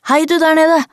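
high-pitched narrated call. A narrator's reading. Child calls to a body in the ditch, calling out high. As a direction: call down to someone lying below. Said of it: "ropte jeg".

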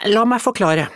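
very high, loud indignant echo; tiny boy. Acted. The tiny boy answering Lise, squeaking with outrage. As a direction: squeak the word back in outrage. Bulle starts speech.

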